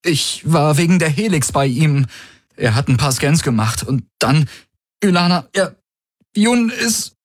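high-pitched, halting breathless report; feigned breathlessness. A narrator's reading. Man reports his friend's collapse to Ylana, feigning breathlessness. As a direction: act out of breath, breaking off mid-sentence. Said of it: "er gab sich atemlos".

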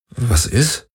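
a touch of puzzled irritation, rising at the end; mildly irritated question. A narrator's reading. Garland noticing Kirai flinch, mildly irritated. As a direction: ask what's wrong, a bit annoyed. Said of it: "fragte er irritiert".

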